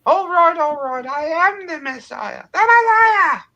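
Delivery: Monty Python mother shrieking; shrill mother. Acted. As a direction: squawk like an outraged mum. Life of Brian mother impression.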